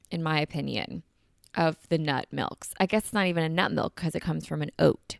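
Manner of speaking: ordinary delivery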